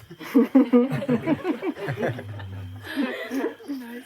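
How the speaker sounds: long, high helpless laughter. Real, not acted. Teacher cracking up at his own joke, long high laugh. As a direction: laugh until it nearly turns to tears.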